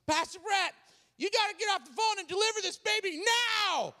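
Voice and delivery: high-pitched urgent yelling; imitated yell. Assistant ordering pastor off the phone, urgent yell. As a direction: yell an order in mock desperation. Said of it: "I hear my assistant yell at me".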